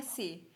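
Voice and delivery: German C named से, with an "ay" vowel; German letter C. Shown as a demonstration. Teacher showing learners German C, से as "seh". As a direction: say से as "seh", not "see". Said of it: "सी को हम बोलते हैं".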